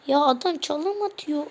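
ordinary talk, nothing marked

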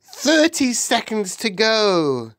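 high, drawn-out countdown call; witch crying out. A narrator's reading. Witch gripping the boy, calling the countdown, crying out. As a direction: cry it out slow and high. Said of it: "cried the Grand High Witch".

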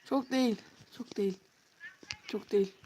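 sheepish denial trailing off into silence; fading mutter. Shown as a demonstration. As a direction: let it die away to nearly nothing. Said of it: "repeated fading takes".

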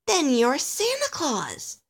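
high, slow, amazed; astonished realization. Acted. Alistair learning his friend is Santa, astonished. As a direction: draw it out high in wonder.

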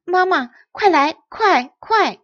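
loud, urgent calling; urgent shout. Acted. Girl shouting urgently for her mother to come. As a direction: hurry mother over with a shout. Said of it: "惠美子发出大声喊".

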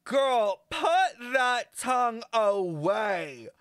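loud, high, slow and stretched-out order; scandalized scolding. Real, not acted. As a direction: scold the screen in shock. Scolding game character's tongue.

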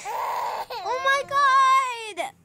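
high, very slow acted dismay; dismayed teacher. Teacher reacts to the crying child, dismayed. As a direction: draw it out in hammed-up alarm.

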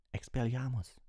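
high, forceful cry; called-out spell. A narrator's reading. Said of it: "rief er".

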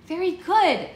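high, loud burst of praise; cheering. A narrator's reading. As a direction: cheer him on brightly. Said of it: "Bristol cheered".

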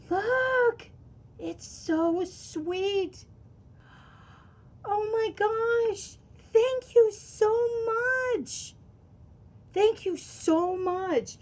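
high, slow, gushing thank you; thrilled thanks. Real, not acted. Thanking the sender for the deck, gushing high. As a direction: melt over the gift.

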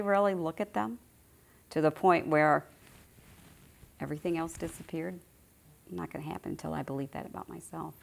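ordinary delivery

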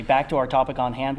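ordinary talk

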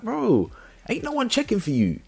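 dismissive retort. Retold or imitated. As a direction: shoot her down in a high-pitched voice. Scornful retort to her claim.

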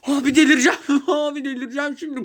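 laughing through frustration; exasperated laugh while talking; laughing exasperation. Real, not acted. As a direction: laugh it out, at the end of your rope.

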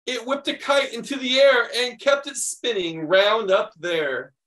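animated picture-book reading; reading to children. Acted. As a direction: read it like story time for kids. Reads picture book aloud.

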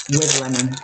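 low, dry sarcastic repeat. Acted. Doubting the lemon flavour on the cake, dry sarcastic. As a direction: say it like the lemon is a lie. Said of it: "We're using that term very loosely".

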